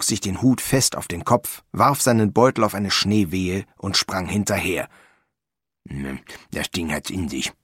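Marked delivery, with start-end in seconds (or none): 5.9-7.5 low, soft gnome mutter; grumbled under the breath. A narrator's reading. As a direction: mutter it to yourself, low and quiet. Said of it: "murmelte er".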